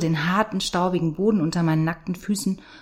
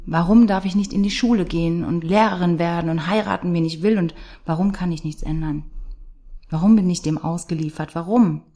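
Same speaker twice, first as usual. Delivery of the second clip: louder, pressing every warum; anguished outcry; 4 dB louder than usual. Acted. Girl protesting her unfair life, anguished loud questions. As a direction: cry out the whys against fate.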